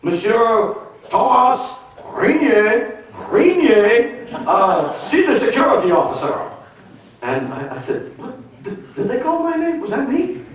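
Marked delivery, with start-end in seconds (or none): 0.0-6.5 put-on slow, high airport PA announcement with a French accent; French-accented airport announcer calling his name, high and slow; loudspeaker announcement. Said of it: "over the loudspeaker". 8.2-10.4 high, incredulous double-take; who, me? high and stunned; startled disbelief. Retold or imitated. Startled at hearing his name.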